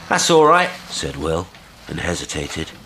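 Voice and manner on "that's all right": loud, high reply; called reassurance. A narrator's reading. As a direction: reassure someone out on the water. Will calls back.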